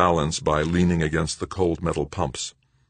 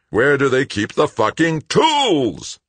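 high, drawn-out full-force roar; furious roar; 10 semitones higher, 6 dB louder, slower than usual. A narrator's reading. Greg hunting through lockers for tools, roaring. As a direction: roar it out, stretching the last word. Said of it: "His roar echoed".

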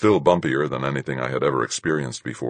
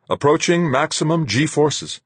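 high, raised intercom call-out; 12 semitones higher, 4 dB louder than usual. A narrator's reading. Duchamp reporting G forces over the intercom, calling out. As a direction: call it out, pitched well up. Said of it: "voice called out over the intercom".

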